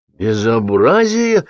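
drawn-out show of outrage; perfunctory outcry. A narrator's reading. Staffer exclaiming for form's sake to please boss, mock outraged. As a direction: cry out slowly for form's sake. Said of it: "вскрикнул для порядка Сапфиров".

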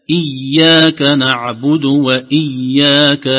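إياك with a light, unstressed ي, slow chant; correct إياك. Shown as a demonstration. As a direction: chant إياك gently, not pressed. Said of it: "ليست إياك". (إياك بياء خفيفة)

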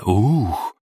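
amazed crowd sound; surprised crowd gasp. A narrator's reading. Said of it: "удивлённое".

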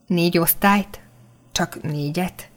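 stunned, half to herself; taken aback. A narrator's reading. Juli is startled by his schooling, quietly taken aback. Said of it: "Hökkentem meg".

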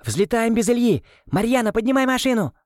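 high urgent shout. A narrator's reading. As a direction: scream the command to take off. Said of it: "и закричала". (громкий срочный крик)

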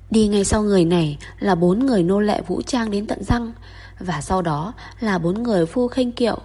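plain reading voice

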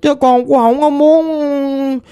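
lightly voiced ghost girl, plaintive and mournful; a hint of a lost spirit lamenting.

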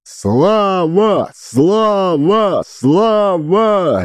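high chanted roar; drunken roaring. A narrator's reading. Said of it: "ревели пьяные глотки".

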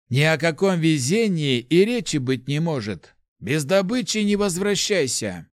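loud, stern, high-pitched command. A narrator's reading. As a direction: lay down the law sternly. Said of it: "строго ответил маг". (строго)

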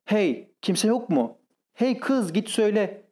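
high, drawn-out call turning to orders; urgent calling out. A narrator's reading. Master calling the maid to warn her, urgent high call. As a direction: summon help urgently without full volume. Panicked master calls for servants.

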